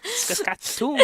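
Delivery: put-on squeaky high Canadian-accented "Saskatoon"; Canadian dude impression. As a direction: squeal "Saskatoon" like the goofy Canadian guy from Grown Ups. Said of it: "He kinda sounded like that, the Canadian dude from".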